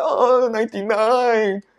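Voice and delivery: high, slow, dismayed sigh; mock dismay. Speaker telling her classmate the score, slow mock dismay. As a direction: drag it out as if it were a disaster. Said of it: "Then I'll be like".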